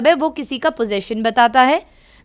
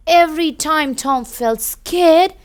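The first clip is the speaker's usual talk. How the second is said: storybook reading; 4 semitones higher, much slower than usual. A narrator's reading. Reads storybook to children.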